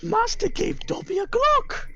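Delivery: squeaky Dobby voice. Retold or imitated. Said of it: "Master came back for Dobby".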